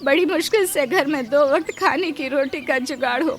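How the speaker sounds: anxious maid begging to keep her job; distressed, imploring servant; pleading maid. Acted.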